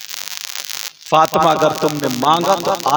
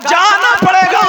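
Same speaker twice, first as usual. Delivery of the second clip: commanding shout; 6 dB louder than usual. Acted. Husband ordering his wife to go, high commanding shout. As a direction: ring out the command like a preacher.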